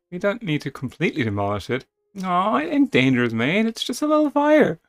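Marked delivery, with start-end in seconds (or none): 2.2-4.8 high, loud casual dismissal; cheeky higher reassurance; Kit's cheeky voice. Acted. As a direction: brush off the danger. Kit shrugging off danger.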